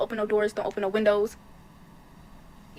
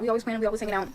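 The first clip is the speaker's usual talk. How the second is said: normal talking voice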